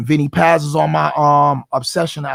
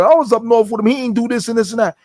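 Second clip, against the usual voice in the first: higher-pitched imitation of a naysayer; dismissive detractor; 7 semitones higher, faster than usual. Detractor talks down his prison record, mock dismissive. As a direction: voice a gossip discrediting someone, pitched up.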